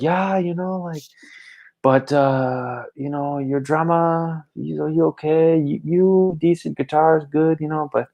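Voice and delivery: high, lightly slurred critic; drunk fan voice. Retold or imitated. Drunk fan critiquing each band member. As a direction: list the flaws like a tipsy critic.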